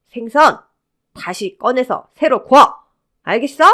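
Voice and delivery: angry, forceful kitchen command; angry order. Acted. Mother-in-law ordering the fish grilled anew, angry. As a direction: order her to redo it, brooking no argument. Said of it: "한바탕 화를 내고는".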